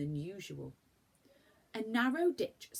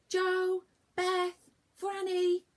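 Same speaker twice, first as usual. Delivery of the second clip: high, loud call across the garden; calling from afar; 14 semitones higher, 10 dB louder, much slower than usual. Acted. Mother calls the children in from the cottage. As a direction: call them home. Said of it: "came their mother's voice from the cottage".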